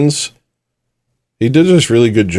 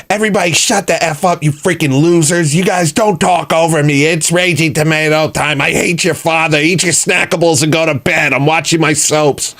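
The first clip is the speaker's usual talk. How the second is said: high, mocking rant as the host; hammed-up high bossy rant; 7 semitones higher than usual. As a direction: mimic her snapping at chat. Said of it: "and she was like".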